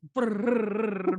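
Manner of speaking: loud trilled "brrr" babble; noisy voices imitation. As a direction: roll out a loud burst of mock chatter. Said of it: "making all kinds of voices".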